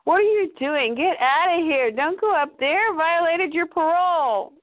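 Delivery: high, urgent voice of conscience; inner voice. Retold or imitated. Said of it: "there's an inner voice that says".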